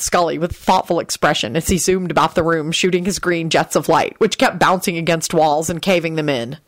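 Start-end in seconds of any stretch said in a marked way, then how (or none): none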